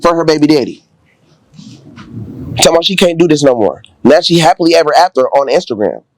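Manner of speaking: loud and worked up about the story; heated. Real, not acted. Telling friends about the balcony night, loud and heated.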